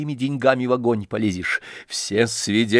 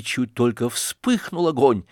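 usual speaking voice

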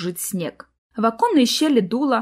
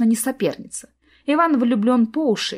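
ordinary delivery

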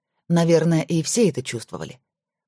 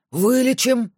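higher, eager exclaim; fervent promise; 8 semitones higher, slower than usual. Acted. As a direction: promise it with heat. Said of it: "горячо уверил Макаров". (горячо, с жаром)

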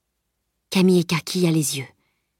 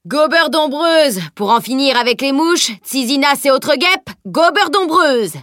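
hawker's call; 7 semitones higher, 4 dB louder than usual. A narrator's reading. Merchant hawking his insect-eating lizard to passers-by, calling out. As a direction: hail passers-by loudly, pitched up and stretched. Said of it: "hélait les passants".

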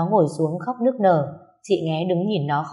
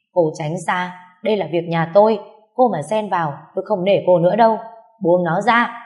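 louder, menacing warning; angry threat; 5 dB louder than usual. Acted. Husband threatening Nghé to stay out, angry and loud. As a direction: warn her off, rising to a shout.

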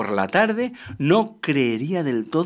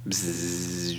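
fly buzz; 7 semitones lower, 8 dB quieter than usual. Retold or imitated. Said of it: "espantándoles las moscas".

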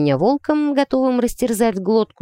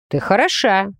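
ordinary delivery, nothing marked

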